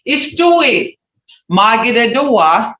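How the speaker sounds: "Stewie. Magidadua." said loud and clear; Muskogee phrase. Shown as a demonstration. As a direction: announce the phrase for the class. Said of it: "How do you say in Muskogee?".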